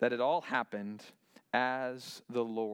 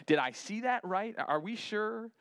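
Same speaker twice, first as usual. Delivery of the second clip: higher, questioning disbelief; mock disbelief; 7 semitones higher than usual. Pharaoh doubts the snake he just saw, mock disbelief. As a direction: act out doubting your own eyes, pitch lifted. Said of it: "He's kinda rubbing his eyes".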